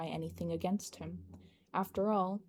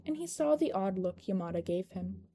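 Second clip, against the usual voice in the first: usual speaking voice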